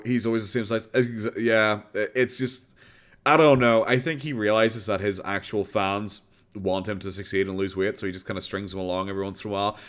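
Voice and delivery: normal talking voice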